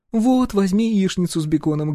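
a firm woman choosing for him; bossy wife. A narrator's reading. Wife orders for husband.

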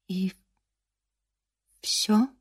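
barely audible, soft. A narrator's reading. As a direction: say it almost inaudibly. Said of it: "чуть слышно выговорила она".

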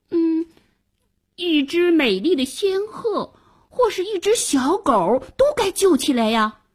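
put-on high, earnest child's voice; thoughtful boy. Boy reasoning with old grandpa about rescues, thoughtful child voice. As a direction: think aloud like a keen little boy. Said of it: "王小二歪着头想了想".

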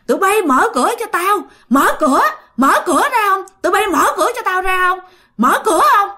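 high, hammed-up yell at the locked door; possessed mother yelling. Mother pounding the door yelling at the family, high mock yell. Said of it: "mà la rất là lớn".

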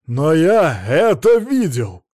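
loud, high, slow and emphatic; insistent protest. A narrator's reading. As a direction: hammer out your protest, stretching each word. Witness protests he saw it.

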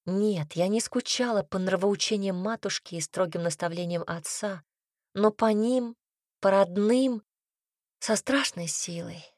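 ordinary delivery

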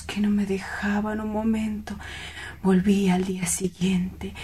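hushed and far away in the past; dreamy whisper. Acted. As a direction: whisper it like a treasured memory. Lovesick man recalls her gaze.

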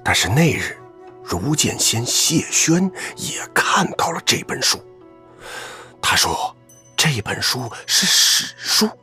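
deliberately hushed, secretive telling. Acted. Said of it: "你不要刻意压低声音".

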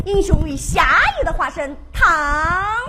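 ordinary delivery

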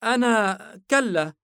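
put-on high, loud child's voice, hesitant; small boy admitting it haltingly; flustered little boy. A narrator's reading. Embarrassed son admits to father he cannot ride, flustered child voice. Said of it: "آش مخفضا رأسه بارتباك".

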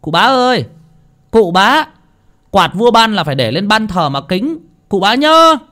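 high, loud call from behind the house; yelling after him. Acted. Boy yells after the departing landlord, calling loudly. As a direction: shout it after him as he leaves. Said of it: "thằng Bờm đã gào lên".

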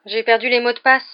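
a fretful lament. A narrator's reading. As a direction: wail it in mild dismay. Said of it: "se lamenta Neville".

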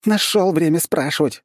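high, sharp retort; snappy bark. Acted. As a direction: snap back at him. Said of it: "гаркнула Тами".

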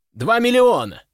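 high, sudden bidding shout; called-out bid. A narrator's reading. Narrator's hero opens bidding on the crown, calling out high. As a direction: throw the bid across the hall.

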